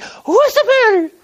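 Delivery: high, far above his voice, concerned question; wife asking. Retold or imitated. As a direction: pitch it up like his worried wife. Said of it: "She's like".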